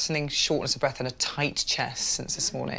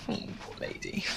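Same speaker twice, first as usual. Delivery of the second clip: soft, breathless whisper to herself; whispered aside; 9 dB quieter than usual. Real, not acted. Doctor whispering to herself after the patient leaves, sympathetic. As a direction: sigh it out under your breath.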